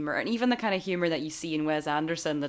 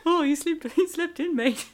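put-on quoting a line in a Kiwi accent; playful New Zealand accent, quickly; 11 semitones higher than usual. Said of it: "Their accents, the New Zealand accent".

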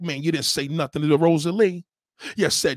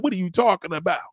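ordinary delivery

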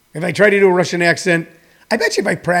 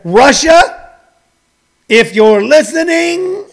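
raised pitch, drawn out and rising; mock public appeal; 9 semitones higher, 5 dB louder, much slower than usual. Comedian addressing Russia about its vaccine, mock public appeal. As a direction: call out slowly to a far-off listener.

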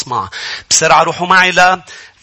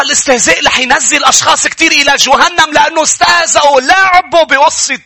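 high-pitched, emphatic sermon delivery; raised preaching voice with drawn-out key words; forceful preaching; 8 semitones higher, 3 dB louder than usual. Real, not acted. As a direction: warn the congregation urgently. Preacher reads and warns with force.

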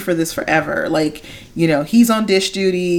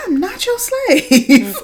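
high, slow, laughing mock protest; kid's protest; 13 semitones higher, 3 dB louder, much slower than usual. Child protesting to mom, high and laughing. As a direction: squeal it as a kid, half laughing.

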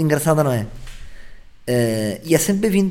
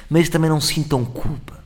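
ordinary talk, nothing marked

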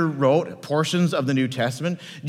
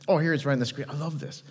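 ordinary talk

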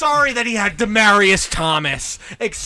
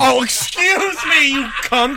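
sarcastic; 5 semitones higher, slower than usual. Acted. Sarcastic mock apology.

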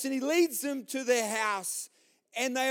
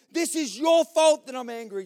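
loud, high-pitched comic outburst; angry shouting; 6 semitones higher, 8 dB louder than usual. Acted. Man turning on his wife, angry. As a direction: blame her at full voice. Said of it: "gets angry and he starts screaming".

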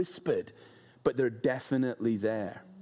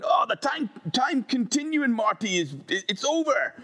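higher, louder frantic mad scientist; hammed-up panicked inventor warning Marty; Doc from Back to the Future; 11 semitones higher, 3 dB louder than usual. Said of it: "I can imagine Doc from, Back to the Future going".

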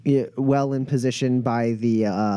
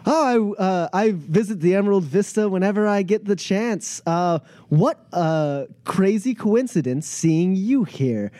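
higher-pitched, gushing host; effusive Pep; 7 semitones higher than usual. Acted. Pep greeting a player at the cantina, effusive. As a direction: greet an old friend with showy delight. Plays Pep feigning a warm welcome.

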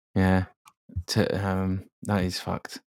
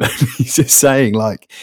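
normal talking voice

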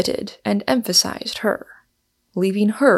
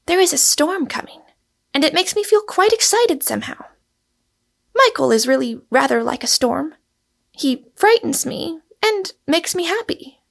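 put-on high-pitched, excited young woman character; high, stirred-up and eager; excited Rosamond; 8 semitones higher than usual. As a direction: confess the thrill of the storm in a high voice. Voices Rosamond excited by the storm.

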